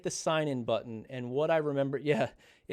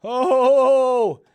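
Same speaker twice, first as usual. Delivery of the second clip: higher and louder, laughing as he boasts; triumphant cheer; 9 semitones higher, 10 dB louder, slower than usual. Real, not acted. Dad shows the family his result, cheering and laughing. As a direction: crow about the win with a laugh.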